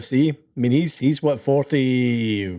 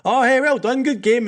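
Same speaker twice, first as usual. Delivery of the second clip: high, bright call of good game; a friendly shout after the match; cheery greeting; 9 semitones higher than usual. Retold or imitated. Cheery greeting to French fans.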